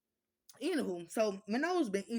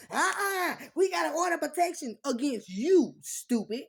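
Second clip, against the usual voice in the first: mocking the court people; 6 semitones higher, 8 dB louder than usual. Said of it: "people would, you know".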